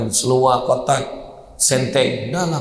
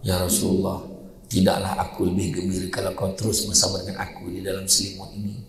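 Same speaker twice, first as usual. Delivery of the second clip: soft tender wife; 5 semitones lower, 7 dB quieter than usual. Retold or imitated. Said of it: "Aisyah kata apa".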